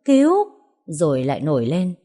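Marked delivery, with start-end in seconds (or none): 0.1-0.3 panicked cry for help. Acted. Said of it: "Cô hoảng sợ".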